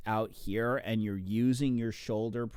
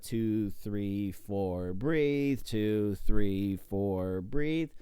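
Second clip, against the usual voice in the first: counting strokes; 3 semitones lower, much slower than usual. Shown as a demonstration. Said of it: "I like to have my face in the water".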